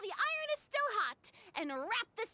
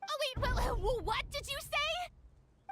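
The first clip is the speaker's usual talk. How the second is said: stunned; 6 semitones higher, 4 dB louder than usual. Acted. Shock at lost film.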